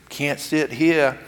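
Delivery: put-on slow, drawn-out Southern kid refusal; overdone Southern accent. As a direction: play a kid with a hammed-up Southern drawl. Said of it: "with an overdone Southern accent".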